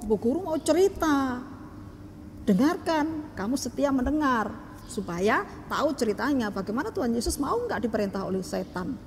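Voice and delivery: usual speaking voice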